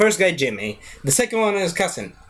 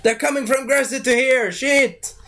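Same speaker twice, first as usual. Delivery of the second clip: high panicked cry; 8 semitones higher than usual. Retold or imitated.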